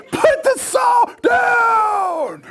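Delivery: high, very slow drawn-out yell; acted dad's exasperated holler; desperate shouted order. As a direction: stretch each word over the roaring engine. Father yelling again over saw.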